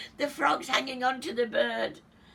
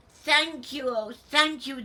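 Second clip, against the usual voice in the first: grateful cry; 6 semitones higher, 5 dB louder than usual. Acted. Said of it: "the grateful witch cried".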